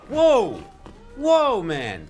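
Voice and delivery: high, loud whoa, then easing; startled high-pitched outburst; shocked. Real, not acted. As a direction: exclaim in shock at the blow. Shocked by the hammer attack.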